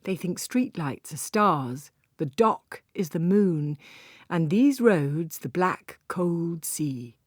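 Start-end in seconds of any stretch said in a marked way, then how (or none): none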